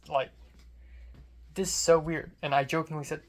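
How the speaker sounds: ordinary talk, nothing marked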